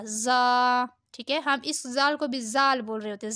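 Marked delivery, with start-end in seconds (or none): 0.0-0.8 "زا" with a hard buzzing z, held long. Shown as a demonstration. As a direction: press the z firmly in "زا". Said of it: "یہ سختی سے پڑھا جائے گا".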